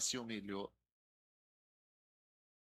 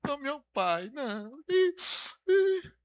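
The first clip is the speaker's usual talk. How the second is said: mock crying prayer; 5 semitones higher, much slower than usual. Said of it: "fica em casa chorando".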